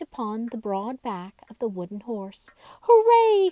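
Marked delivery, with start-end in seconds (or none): 2.8-3.5 much higher and louder joyful whoop; cheering. A narrator's reading. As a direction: cheer as if at a Wild West show. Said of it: "cried all the dolls".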